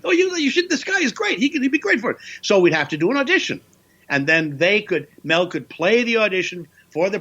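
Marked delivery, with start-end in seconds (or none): 0.0-2.2 very high-pitched excited endorsement; high, rushing impression of an enthusiastic boss; Mel Brooks. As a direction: imitate an eager director pitching an actor. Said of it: "so Mel said".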